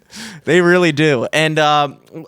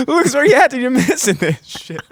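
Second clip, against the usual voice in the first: laughing call; 9 semitones higher than usual. Retold or imitated. Laughing, calling friend on phone.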